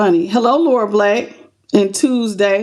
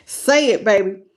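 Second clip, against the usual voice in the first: normal talking voice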